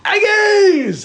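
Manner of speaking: high, drawn-out gleeful shout; excited cheer. Pretend. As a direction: belt it out like a game show cheer. Hypes up the next ingredient.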